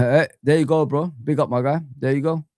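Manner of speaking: ordinary talk, nothing marked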